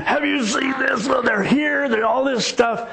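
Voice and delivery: high, panicked servant's cry; freaking out. Retold or imitated. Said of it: "the guy just freaks".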